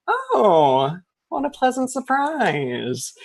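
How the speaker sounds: a witch feigning delight at guests; sweet old witch. Acted. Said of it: "Agatha steps out and she goes".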